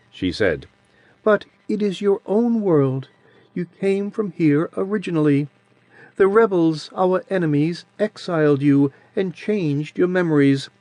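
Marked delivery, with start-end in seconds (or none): 1.2-10.7 put-on sweet childish voice. A narrator's reading. Adiran's voice held.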